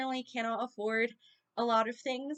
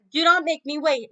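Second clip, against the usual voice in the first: growling; 4 semitones higher, 8 dB louder than usual. A narrator's reading. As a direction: growl it as a threat. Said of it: "Neptune growled".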